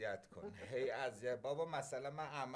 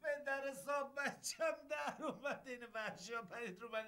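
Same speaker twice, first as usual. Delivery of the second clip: laughing complaint; 9 semitones higher than usual. Acted.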